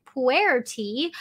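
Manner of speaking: usual speaking voice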